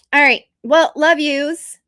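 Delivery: ordinary talk, nothing marked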